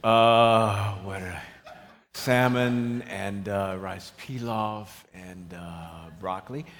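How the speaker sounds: low, slow, dragged-out answer; grudging attitude. Acted. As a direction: drag out each item reluctantly. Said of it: "I'm starting to get an attitude".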